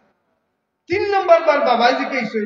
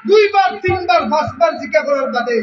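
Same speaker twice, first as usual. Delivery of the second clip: fiery preaching; 4 semitones higher than usual. Acted. Preacher voicing the father's hurt at his son at full force. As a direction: voice the old father's diary as a fiery sermon.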